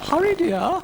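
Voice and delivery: very high, fluting ladylike voice; dotty lady cow. Acted. Ermintrude doubts Brian is himself, high fluting voice. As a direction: ask it in a high, dotty flute.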